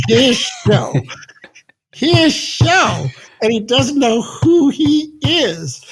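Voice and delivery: high, laughing through the words; laughing disbelief. Retold or imitated. Retelling the guy's remark, laughing high and disbelieving. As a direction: crack up while quoting him. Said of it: "here this guy said".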